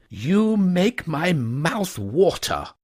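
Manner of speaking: high, slow, dreamy gentleman; lightly voiced Wooster; longing toff. A narrator's reading. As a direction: savour the thought.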